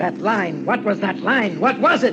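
agitated questioning that climbs in pitch; obsessive urgency. Acted. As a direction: build from fixation to frantic demand. Obsessed man hunting forgotten line.

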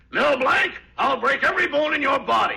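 high, loud angry father threat; imitating Betty's father. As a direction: bellow it like the furious dad you've heard yelling. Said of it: "See if I don't sound like your father".

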